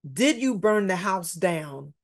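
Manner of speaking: normal talking voice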